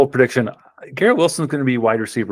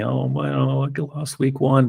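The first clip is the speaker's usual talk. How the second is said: higher-pitched, drawn-out mock pouting; pouty Eric; 5 semitones higher than usual. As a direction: whine it slowly in a teasing impression of a sore loser. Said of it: "Eric will be, you know, all pouty".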